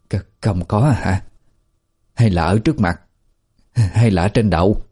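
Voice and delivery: put-on crestfallen, hesitant old woman; mildly dejected, puzzled searching; tiêu nghỉu. A narrator's reading. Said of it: "tiêu nghỉu nói". (giọng tiu nghỉu, hụt hẫng nhẹ)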